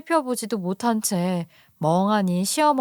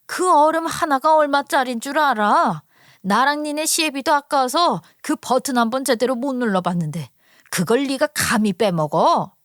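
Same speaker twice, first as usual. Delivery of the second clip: angry in-law scolding, building in force; mother-in-law's temper. Acted. Mother-in-law berating daughter-in-law over fridge ice, angrily. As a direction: lay into her with indignant, accusing questions. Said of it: "시어머니가 성질을 부리면서 말을 하더라고요".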